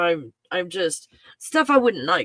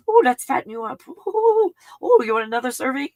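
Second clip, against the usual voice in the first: high, loud, fussing mama voting more food on the pup; doting Italian mom; 7 semitones higher, 5 dB louder than usual. Pretend. Dog mom fussing over her thin puppy, high doting Italian mama voice. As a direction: coo and fuss like a nonna pushing seconds. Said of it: "feeling like an Italian mom".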